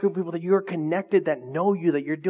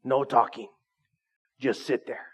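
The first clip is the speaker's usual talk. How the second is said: mean teacher; 5 semitones lower, 3 dB quieter than usual. Retold or imitated. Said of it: "like that mean teacher that you had".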